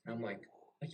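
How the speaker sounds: usual speaking voice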